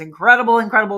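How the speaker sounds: loud gushing incredible; enthusiastic rave. Real, not acted. Host raving about a show she loved, loud and excited. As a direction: burst out with incredible. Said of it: "raving about The Outsiders".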